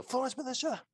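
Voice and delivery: high ringing call out; yelling. Acted. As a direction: shout it up the stairs. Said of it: "I'm gonna yell".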